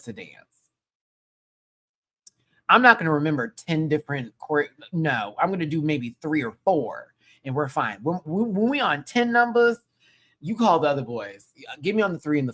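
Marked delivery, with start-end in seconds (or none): none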